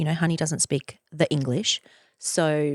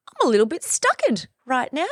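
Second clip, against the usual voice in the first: high, put-on childish whine; a silly pouty voice; mock stuck voice; 7 semitones higher, 5 dB louder than usual. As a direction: play up being stuck. Joking about feeling stuck.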